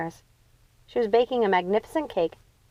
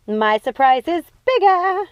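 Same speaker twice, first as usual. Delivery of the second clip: higher, louder yell, a bit slow; 9 semitones higher, 6 dB louder, slower than usual. Acted. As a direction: shout it up to the tower. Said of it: "yelled Gothel".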